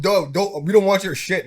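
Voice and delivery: loud, dismissive quote; mock loud refusal. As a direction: shout it off like a boss. Voicing Trump's refusal.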